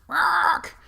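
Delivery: loud high creature cry; creature shout. Acted. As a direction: bellow it out like a monster. GM voices creature calling out.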